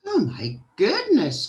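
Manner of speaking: high, loud dismayed exclamation; startled storybook princess, swooping pitch; shocked cry. A narrator's reading. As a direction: exclaim it, taken aback.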